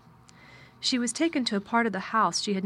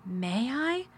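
put-on small, hopeful child voice, pitched higher; tremulous; 4 semitones higher than usual. A narrator's reading. Girl asking her guardian's permission, mildly tremulous. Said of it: "she said tremulously".

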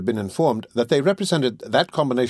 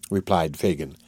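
ordinary delivery

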